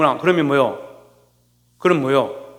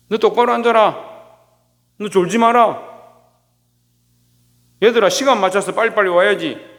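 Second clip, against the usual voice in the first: scolding teacher; 5 semitones higher than usual. Retold or imitated. Sunday school teacher scolding sleepy children, mock stern. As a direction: bark orders at restless kids, hammed up.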